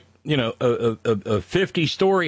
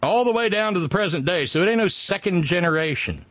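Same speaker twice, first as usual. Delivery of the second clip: put-on southern accent; 4 semitones higher than usual. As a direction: lay on a rural Southern accent. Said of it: "you got me using ain't and my southern accent".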